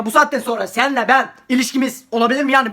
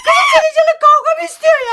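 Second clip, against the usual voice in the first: put-on much higher, shrill-sounding mean girl; bully girl voice; 4 dB louder than usual. Bully girl snaps at the girl in the toilet. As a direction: play a loud, nasty bully.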